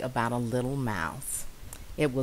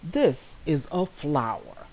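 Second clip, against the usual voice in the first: bee voice; 3 dB louder than usual. Acted. Bee character speaks.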